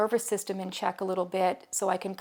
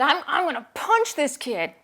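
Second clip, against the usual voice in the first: put-on high, loud furious child; angry boy threatening to punch; angry kid threat; 7 semitones higher, 6 dB louder than usual. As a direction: snap it out like an enraged five-year-old. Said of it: "he was really angry".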